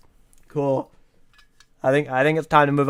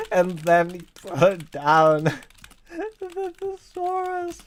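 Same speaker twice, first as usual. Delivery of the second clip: laughing; 14 semitones higher than usual. Real, not acted. Cracking up reading own story.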